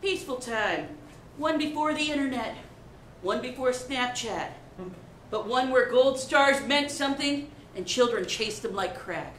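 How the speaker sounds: solemn mock-documentary narrator; documentary narration.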